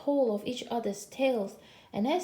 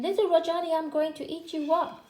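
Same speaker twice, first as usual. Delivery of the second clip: higher-pitched, emphatic tiger threat; tiger's threat; 6 semitones higher, 3 dB louder than usual. A narrator's reading. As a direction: announce the threat with a high lift. Third tiger threatens the boy.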